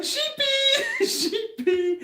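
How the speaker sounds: very high, sing-song call through laughter; Rachel impression. Owner mimicking Rachel calling the lamb, very high and laughing. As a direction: squeak it out like Rachel calling her lamb, giggling. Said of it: "I'm trying to sound like Rachel".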